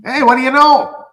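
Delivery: loud, high South Shore Nova Scotian impression; Lori Wickens. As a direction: holler it with a local's flavor. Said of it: "trying to give a bit of the flavor".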